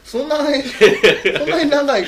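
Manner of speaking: high, loud, drawn-out protest; indignant protest. Real, not acted. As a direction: stretch out the outraged question. Protesting the long-face joke.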